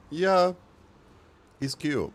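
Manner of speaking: ordinary talk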